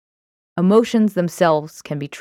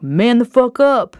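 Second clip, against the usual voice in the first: loud, higher scolding command; raised-voice tough-love order; harsh parent's order; 7 semitones higher, 6 dB louder than usual. Acted. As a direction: voice a parent telling a kid off, loud.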